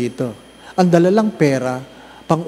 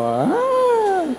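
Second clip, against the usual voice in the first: mock realization. Mock revelation.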